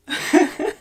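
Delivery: squealing laugh, high and loud; high burst of laughter. Real, not acted. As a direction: let a high giggle burst out. Delighted reaction to chat.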